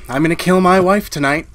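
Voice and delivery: loud, driven declaration of murder; loud confession. Acted. Husband announcing he will kill his wife, loud. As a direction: blurt out the plan to kill, loud.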